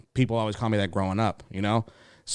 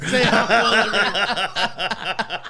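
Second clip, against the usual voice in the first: higher, loud and slow, laughing through it; 10 semitones higher, 6 dB louder, much slower than usual. Pretend. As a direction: stretch the line out while laughing. Repeating line laughing.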